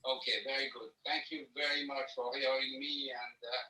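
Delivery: faint voice, far from the phone; speaking from across the room on speakerphone; phone held away. Real, not acted. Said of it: "why don't you hold up your phone and talk into the phone so".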